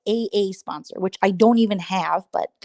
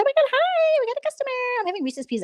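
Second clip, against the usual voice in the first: much higher, chirpy squeal of a distracted streamer; squirrel impression; faster than usual. Mocking the squirrel, squeaky high voice. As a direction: squeal it like a ditzy fan greeting a customer.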